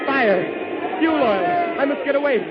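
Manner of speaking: high, loud, panicked cries; alarmed shout. Acted. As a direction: yell as the fire starts. Sees fire after the crash.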